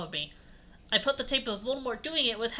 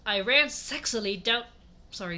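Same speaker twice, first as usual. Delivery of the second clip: plain reading voice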